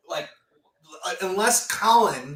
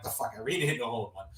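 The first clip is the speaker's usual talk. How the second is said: lower, softer, fast disbelief; quietly stunned; stunned mutter; 8 semitones lower, 7 dB quieter, much faster than usual. Retold or imitated. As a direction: mumble it to yourself in shock. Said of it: "I'm like".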